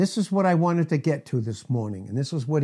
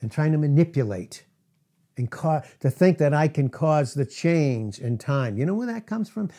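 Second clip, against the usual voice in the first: ordinary delivery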